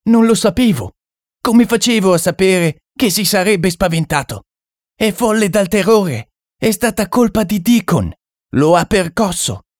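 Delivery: high, desperate excuses; frightened pleading. Acted. The Frenchman excuses himself to the narrator, frightened pleading. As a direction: plead it wasn't your fault.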